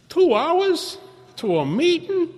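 high, loud, drawn-out disbelief; incredulous. Retold or imitated. Balks at the long drive.

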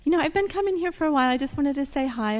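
fast high-pitched eager voice; eager greeting. Retold or imitated. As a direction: play it chirpy and nervous. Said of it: "I was like".

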